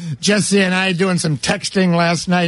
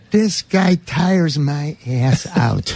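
slow, low, worn-out complaint; weary deadpan; 4 semitones lower, slower than usual. Retold or imitated. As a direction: drag each word out in weary exasperation. Reading out his own exasperated text.

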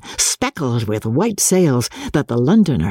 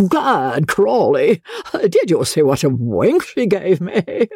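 boastful dandy; 4 semitones higher than usual. A narrator's reading. Joss boasts to friends.

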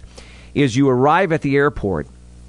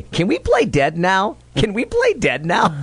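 imitating little boy; 8 semitones higher than usual. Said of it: "twice you can hear the kid say".

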